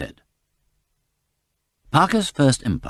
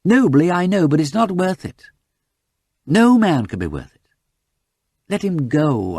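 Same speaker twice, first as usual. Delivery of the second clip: higher, louder earnest imploring; urgent pleading detective; 8 semitones higher, 4 dB louder than usual. Acted. Parker imploring Lady Mary to tell the truth, urgent plea. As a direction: beg her to stop shielding him.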